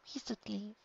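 high, soft, helpless pleading; helpless plea. A narrator's reading. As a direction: plead softly, almost breaking. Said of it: "in such a helpless way".

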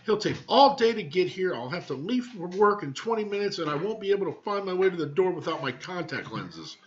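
high, put-upon woman's grumble; grumbling wife. Acted. Wife grumbles to husband about being late, high and sulky. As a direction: sulk through her complaints.

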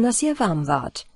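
usual speaking voice

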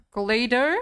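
loud with rising end; sharp rise, as if doubting it; puzzled question. Real, not acted. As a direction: query the word in surprise. Queries word while reading quiz.

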